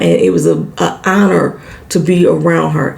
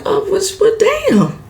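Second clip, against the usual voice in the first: much higher, sassy and delighted; playful sassy praise, pitched way up; impressed, teasing Nova. Retold or imitated. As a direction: hype the girl up like a proud auntie. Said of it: "Nova was like".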